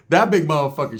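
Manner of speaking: usual speaking voice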